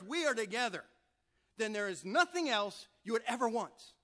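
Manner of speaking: high, loud, joyful emphasis; God thrilled that his child is his; exultant God. Acted. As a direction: burst out with delight. God exulting over belonging together.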